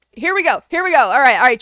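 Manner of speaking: high, loud call to attention; rally the audience mid-rant; fired-up and loud; worked-up rallying. Real, not acted. Rallies viewers for an angry announcement.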